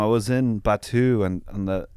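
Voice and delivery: usual speaking voice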